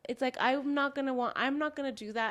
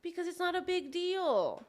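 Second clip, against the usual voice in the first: mocking imitation; 7 semitones higher than usual. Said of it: "mocking his words".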